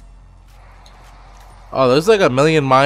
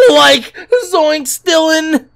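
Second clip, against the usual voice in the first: very high, loud cartoon scream; cartoon panic yelp; 6 dB louder, much slower than usual. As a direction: shriek his name like a scared cartoon.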